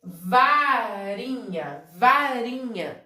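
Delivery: "varinha" slow with stretched vowels; teacher slowly sounding out "varinha"; drawn-out word demo. Shown as a demonstration. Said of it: "E se eu disser aqui".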